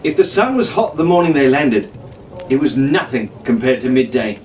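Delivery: ordinary delivery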